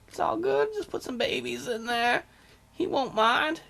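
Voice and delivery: high, oily sweet-talk; sleazy charmer. Acted. King sweet-talking a married spymaster, high and oily. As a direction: coax her like a sleazy lord.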